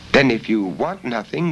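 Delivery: put-on a high, eager young girl's voice; young girl Bella. Bella speaking.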